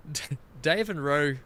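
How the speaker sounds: ordinary talk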